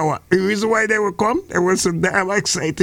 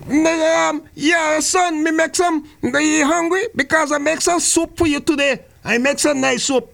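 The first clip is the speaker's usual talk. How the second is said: mother's voice; 7 semitones higher than usual. Acted.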